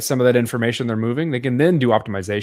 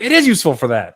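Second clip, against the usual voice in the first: higher, louder mock realization; retold discovery; 4 semitones higher, 5 dB louder than usual. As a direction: exclaim it like a sudden find. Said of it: "we look it up, and, like".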